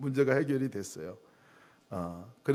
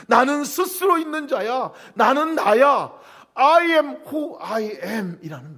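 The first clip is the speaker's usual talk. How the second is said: higher and louder, slowing at the end; God's declaration; 8 semitones higher, 8 dB louder, much slower than usual. Pretend. Preacher voicing God's name, high and loud. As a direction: declare it grandly. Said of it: "스스로 있는 자라는 말".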